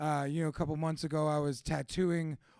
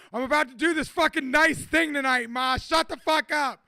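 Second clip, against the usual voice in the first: higher, louder retold outburst at his mom; mock angry yell at mom; 8 semitones higher, 10 dB louder than usual. Son yelling back at his complaining mom, mock-angry shout. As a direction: blow up at Ma like a fed-up son, half-joking. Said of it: "You know, like".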